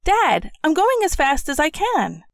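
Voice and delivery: put-on upset child. A narrator's reading. Child protests to father.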